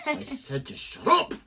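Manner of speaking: high, slow mock scolding; mock angry. Mock quarrel between hosts.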